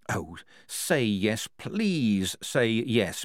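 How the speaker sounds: very slow, drawn-out plea; pleading thought. Acted. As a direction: stretch the words out, willing him on. Polly silently pleads.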